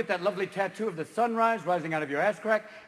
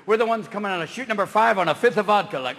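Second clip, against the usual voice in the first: ordinary talk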